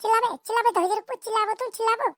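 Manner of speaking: a mother scolding her wailing son; shrill scolding mother. Acted. (ऊँची आवाज़ में ताना मारती माँ)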